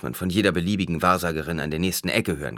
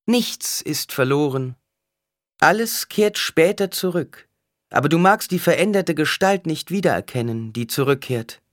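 raised voice, high and measured; oracle's proclamation; 10 semitones higher, 5 dB louder than usual. A narrator's reading. Alia tells the mother all returns, proclaiming. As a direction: declare it to the whole temple. Oracle answers across the hall.

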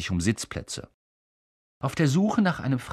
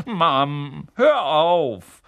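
annoyed protest; 6 semitones higher, 8 dB louder, much slower than usual. A narrator's reading. Boy squirming from mother.

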